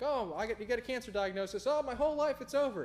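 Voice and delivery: high, loud hammed-up wail of despair; mock despair. Preacher mocks a patient's cancer panic, hammed-up wail. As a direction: overplay someone who thinks life is over.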